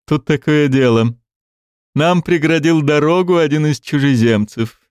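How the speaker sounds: mock-helpless, sarcastic king; dripping irony. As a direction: lay the irony on thick. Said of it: "с сочащейся иронией произнёс он".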